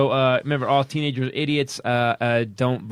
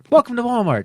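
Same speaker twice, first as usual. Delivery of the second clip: greeter; 9 semitones higher, 7 dB louder than usual. Pretend. Mock store greeting.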